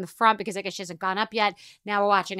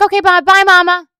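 high, loud, sing-song goodbye; a cheerful kid's singsong farewell; breezy daughter; 8 semitones higher, 12 dB louder than usual. Retold or imitated. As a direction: chirp it like a carefree kid. Daughter's breezy goodbye after 3.5 hours.

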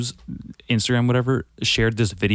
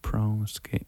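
ordinary delivery

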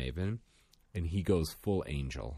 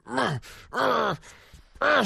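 high, loud frustrated growling; imitating a furious little girl; high grunts of rage; angry toddler growls; 6 dB louder, slower than usual. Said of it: "so mad at me".

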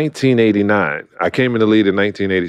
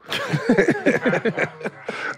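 breathy laugh; 6 dB quieter than usual. Real, not acted. Laughing at his own joke.

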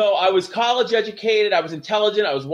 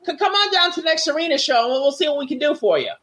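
high, put-on voice of a wrestler leaving a message; Raven; 7 semitones higher than usual. Wrestler leaves a young hopeful an answering-machine message, high mimicked voice. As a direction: imitate his stumbling message, pitched well up. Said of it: "So he's like".

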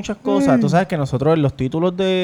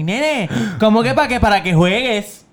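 slow, higher mock mom voice; quoting mother; 6 semitones higher, slower than usual. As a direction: lightly voice your mother scolding. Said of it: "Ay, mi madre". (imitando a la madre, lento y más agudo)

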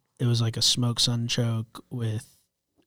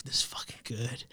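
whispering; 12 dB quieter than usual. Retold or imitated. Chef quote whispered.

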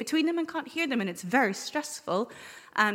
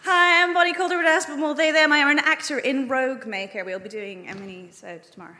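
ordinary talk

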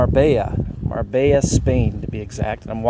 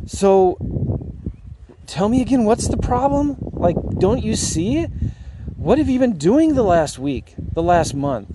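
exasperated; 8 semitones higher than usual. Retold or imitated. Exasperated coach voiced in story.

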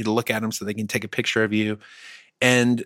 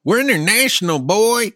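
hyped yokel voice; 10 semitones higher, 4 dB louder than usual. Acted. Put-on hype voice.

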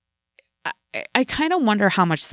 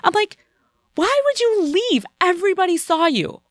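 furious yell; 13 semitones higher than usual. Retold or imitated. Confronting the driver who fled the scene, outraged high yell. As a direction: demand answers in shrill disbelief. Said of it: "seriously, like, so angry".

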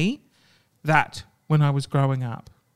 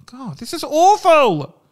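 anguished; 9 semitones higher, 7 dB louder, slower than usual. Real, not acted. Overwhelmed by the story.

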